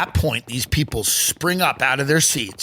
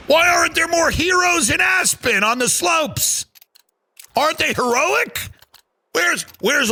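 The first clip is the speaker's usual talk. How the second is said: outraged shout; 12 semitones higher than usual. Acted. Comic yelling at the crowd about Aspen heroes, outraged shout. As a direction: shout it up at the rich.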